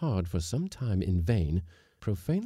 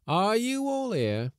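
calling out; 4 dB louder, slower than usual. Acted. Mate calling the crew.

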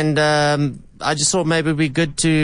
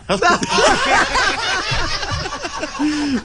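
hearty laugh. Real, not acted. Host laughs after prank reveal.

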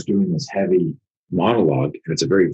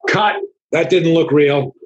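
high-pitched, drawn-out mock director's call; director calling cut; 11 semitones higher, 3 dB louder, slower than usual. Director to the actors after the real punch, mock high call. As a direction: call it out like a director stopping the take.

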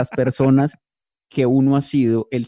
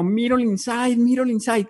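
quoting a friend; 10 semitones higher, slower than usual. Retold or imitated. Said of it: "Como dice un amigo mío".

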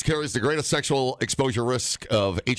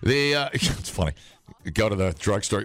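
normal talking voice

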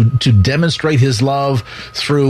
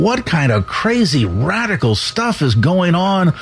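high, incredulous gossip voice; stretched-out, mock-astonished questioning; scandalized eavesdropper; 6 semitones higher than usual. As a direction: mimic a shocked onlooker, pitch climbing into the question. Voicing imagined scandalized eavesdroppers.

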